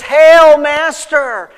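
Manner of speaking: high, loud mock-hearty greeting; loud hailing greeting. Judas loudly hailing Jesus with a betraying greeting, loud. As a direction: call out the false greeting loudly.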